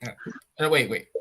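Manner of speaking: ordinary talk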